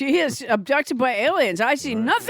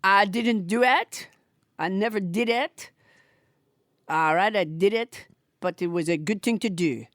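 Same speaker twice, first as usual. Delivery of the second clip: a lower, lightly voiced puppet Trump; French Trump voice; 7 semitones lower than usual. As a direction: deny it all like a sulky puppet Trump. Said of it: "I am French Trump".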